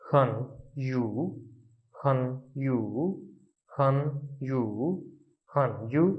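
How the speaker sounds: "हन" falling, "यू" dipping and rising; Chinese tones demo. Shown as a demonstration. Teacher drilling the tones of the word. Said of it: "इसकी pronunciation होगी".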